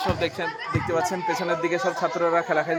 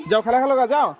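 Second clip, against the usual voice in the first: calling out; 7 semitones higher, 5 dB louder than usual. Real, not acted. Sending boys off to play.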